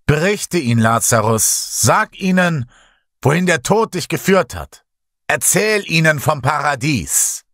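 high, ringing proclamation; loud calling out. A narrator's reading. Messiah calls to Lazarus before the crowd, loud and high. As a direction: call out high so all can hear. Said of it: "rief der Messias so laut, dass alle es hören konnten".

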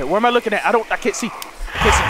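ordinary talk

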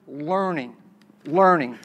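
"learning" set apart and repeated, pitch dropping low; stress on learning. Real, not acted. Presenter emphasizes the key word for the audience, stress on "learning". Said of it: "I emphasize that word".

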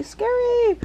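scary squealed very high. Real, not acted.

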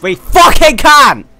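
high, very loud, stretched-out yell. Real, not acted. Gamer urging himself back into the race, determined shout. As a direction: roar it with fierce determination. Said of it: "I'm sorry I raised my voice".

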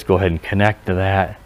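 normal talking voice

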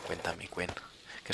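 playful hushed whisper. Pretend. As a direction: whisper it like a secret. Playful whisper about alarm. (susurrando en broma)

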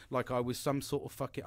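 normal talking voice

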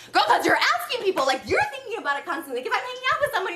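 venting in disbelief, pitched right up; exasperated rant. Real, not acted. Ranting about Gabby, voice shoots up.

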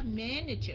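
"Manager" stressed on MAN, the rest light; first-syllable stress. Shown as a demonstration. Teacher correcting the accent mark, stress on first syllable of "Manager". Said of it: "nhấn cái âm đầu".

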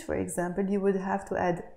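usual speaking voice

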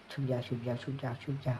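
low, very soft repeated warning; hushed, low-pitched urging to take cover. Real, not acted. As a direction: keep it down while hiding. Urging to hide from approaching enemy.

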